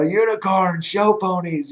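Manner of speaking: imitating an excited radio call; excited announcer. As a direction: mimic a worked-up football announcer. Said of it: "have an announcer get that excited".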